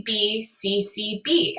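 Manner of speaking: ordinary talk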